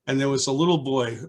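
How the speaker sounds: ordinary talk, nothing marked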